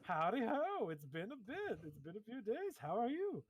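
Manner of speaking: high, bright-eyed clown host greeting; lightly goofy clown welcome; clown octopus professor. Acted. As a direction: greet them like a jolly circus clown.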